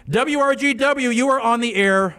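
on-air announcer greeting; radio host intro. Acted. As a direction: announce it like a call-in show host. Host answers line on air.

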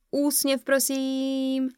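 high, sing-out photographer's call. A narrator's reading. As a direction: call brightly to a group posing for a photo. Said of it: "zvolala jsem". (zvolání vysokým hlasem)

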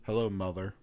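low, cold mock greeting; negative tone. Shown as a demonstration. Son in a bad relationship greeting mother, negative tone. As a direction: drop it low, as if you resent her. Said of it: "if there's a bad relationship".

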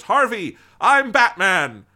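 loud, high, drawn-out shout; yelling over crowd. Retold or imitated. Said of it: "yelling and shouting, and he stands up and goes".

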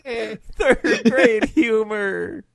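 high, very slow codger voice; hammed-up old-timer; mock old man. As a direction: drag it out like a grumpy grandpa.

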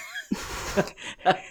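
airy unvoiced laugh; breathy laugh. Real, not acted. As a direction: laugh almost silently. Laugh at guest's joke.

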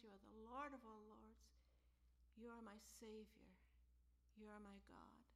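tender whispered prayer; hushed prayer. Acted. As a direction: pray close and quietly, almost a whisper. Voiced prayer grows hushed.

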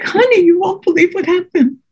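high, breathless mother's voice, lightly voiced; retold mother. A mother telling her daughter at the hospital, high and urgent. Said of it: "she's like".

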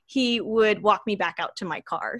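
usual speaking voice

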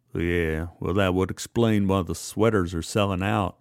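dry boss voice. Acted. Marvin, low dry older man.

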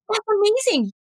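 high-pitched playful gush over amazing; excited praise. Retold or imitated. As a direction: hype him up playfully. Joking praise of the boy.

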